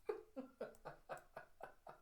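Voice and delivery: high, soft staccato giggle; mock chuckle. She laughs after reassurance, acted high giggle. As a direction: giggle in a quick breathy run.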